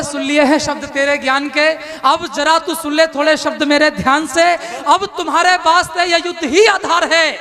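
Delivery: rousing declaimed verse; epic poem recitation. Acted. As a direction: declaim it to a poetry crowd. Krishna's verse.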